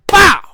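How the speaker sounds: loud, high pow; mock slap sound. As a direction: blurt a slap noise. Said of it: "and slaps the shit out of it".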